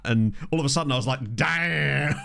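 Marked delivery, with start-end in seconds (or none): 1.4-2.2 long drawn-out "Damn"; mock reaction. Patient retelling the anaesthetic suddenly hitting, drawn-out mock reaction. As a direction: stretch it out like the drug just hit.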